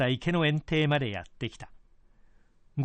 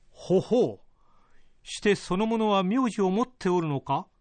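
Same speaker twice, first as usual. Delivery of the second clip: higher, measured lordly voice; feudal lord voice; 5 semitones higher than usual. Acted. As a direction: speak as a pleased old lord. Lord intrigued by news.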